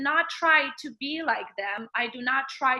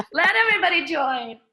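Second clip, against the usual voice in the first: laughing while speaking; words breaking into laughter; 4 semitones higher, 6 dB louder than usual. Real, not acted. Joking about inviting students in.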